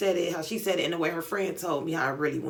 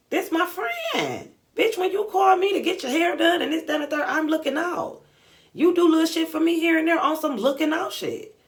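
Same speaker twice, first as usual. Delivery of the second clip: indignant rant; 9 semitones higher, 5 dB louder than usual. Retold or imitated. Telling off the friend who asked for money, indignant. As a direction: retort at a friend, loud and high-pitched.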